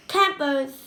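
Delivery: plain reading voice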